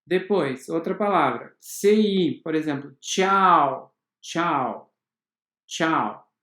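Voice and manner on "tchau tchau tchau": tchau with a crisp tch, said three times; tchau demo. Shown as a demonstration. Teacher to students drilling tchau with tch. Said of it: "T C H".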